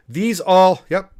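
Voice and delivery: words caught before a sneeze; about to sneeze. Real, not acted. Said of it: "I'm gonna sneeze again".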